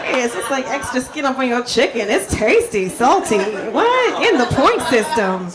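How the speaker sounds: loud, punchy stand-up set; stand-up delivery. Acted. On stage joking to the crowd about her husband, loud and punchy. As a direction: work the crowd with big energy.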